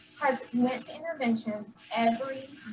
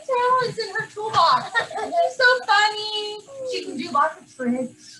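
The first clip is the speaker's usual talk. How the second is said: teary praise; 8 semitones higher than usual. Real, not acted. Teacher emotional praising pupil.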